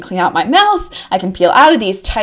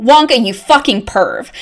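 normal talking voice

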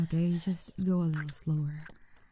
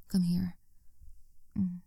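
ordinary talk, nothing marked

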